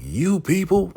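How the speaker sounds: high, loud indignant outburst; acted outrage, YOU PEOPLE thrown out loud and high; mock outraged sheriff. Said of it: "So he's going off".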